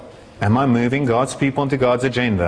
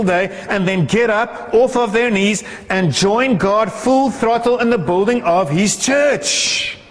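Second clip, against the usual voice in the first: higher-pitched, driving sermon delivery; impassioned preaching; 7 semitones higher than usual. Acted. Preacher urging disciples to pray and build the church, impassioned. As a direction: preach it with rising fervor, stretching the final words.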